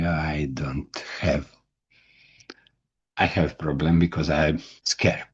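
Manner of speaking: normal talking voice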